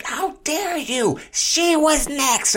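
very high, slow, loud mock indignation; mock outrage. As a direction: ham up a self-righteous scold. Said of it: "virtue signaling how great she was".

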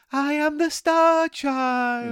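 very high, very slow, otherworldly; drawn-out alien child voice; Star Child voice. Shown as a demonstration. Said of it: "I wasn't doing that voice. I was doing".

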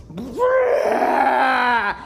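loud, hammed-up lion roar with a rolled growl. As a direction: roar it out like a lion scaring off a big dog. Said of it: "That was a bad one".

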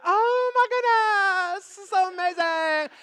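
high, slow, stretched mock rapture; swooning movie heroine. Movie heroine delighted by sudden rain, mock rapture. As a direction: gush slowly in a put-on high swoon. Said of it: "we think it's gonna be like".

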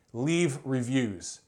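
usual speaking voice

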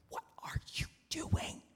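urgent unvoiced whisper; frantic whisper. Servant signalling the steward behind the farmer, frantic whisper. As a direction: mouth it in a panic. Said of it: "he mouthed frantically".

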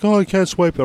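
high cashier imitation; mock cashier. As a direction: voice the cashier turning him down. Cashier voiced in story.

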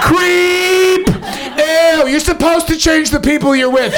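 crank it way up, over the top; shrill mock persona. Comic to the crowd, very high hammed-up rant.

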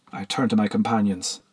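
ordinary delivery, nothing marked

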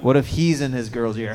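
normal talking voice

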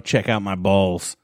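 slow, low mock announcement; catchphrase bit. Co-host redoing his missed gag himself, slow and low. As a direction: draw it out, low. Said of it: "I had to do my own".

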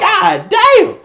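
high, loud exclamation; comic alarm, voice pushed up; retold shock. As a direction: cry out as the attack hits. Retells shock at the dogs.

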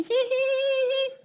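put-on high squeaky kid giggle; tiny mocking hee hee laugh; childish giggle. Kids laughing at their teacher, high squeaky giggle. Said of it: "They're like".

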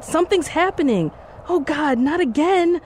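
high, frightened voice; panicked. Acted. Panicked, remembering the shooting.

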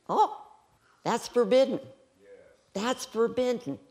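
high, scandalized gasp of words; mock shock. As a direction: play scandalized for laughs. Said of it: "with my sense of humor".

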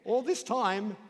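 loud, high, drawn-out astonishment; acted teenage amazement, hammed up; agog. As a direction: exclaim it in slow, soaring disbelief. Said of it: "Joe is agog".

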